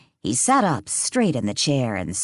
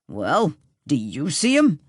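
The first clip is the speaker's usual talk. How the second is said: snapping; 2 semitones higher than usual. A narrator's reading. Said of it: "Chuck snapped".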